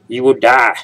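mock warning. Gamer warning viewers about the depth gauge, mock-dramatic. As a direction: belt it out as a joking warning. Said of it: "no, but seriously".

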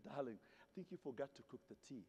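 soft, low, proper British voice; prim British complaint. Retold or imitated. Young [name] telling Marge the tea is cold, soft prim British. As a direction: politely point it out like a prim Brit. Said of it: "And I said".

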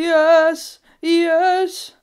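loud, high cheer; triumphant yell. Real, not acted. Gamer celebrating a find, triumphant yell. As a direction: celebrate each word at full voice.

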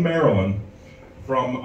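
ordinary talk, nothing marked

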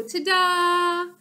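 high, bright reveal call; proud ta-da. A narrator's reading. Rosie unveiling her invention to June, proud ta-da. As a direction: present it with a flourish.

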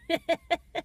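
high laugh in a run of pulses. Real, not acted. Driver joking to viewers about his beard, laughing. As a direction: laugh at your own cheeky line.

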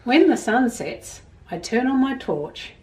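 put-on gentle storybook reading to children; reading a story aloud. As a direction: read it like a picture book to kids. Reads picture book to children.

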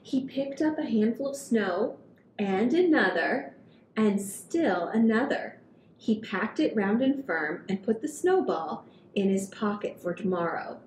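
put-on gentle read-aloud for kids; storybook reading. A narrator's reading. Reading picture book to children.

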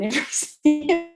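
normal talking voice

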